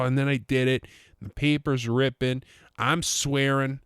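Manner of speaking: ordinary talk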